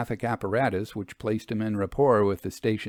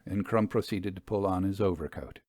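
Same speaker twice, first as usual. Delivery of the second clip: plain reading voice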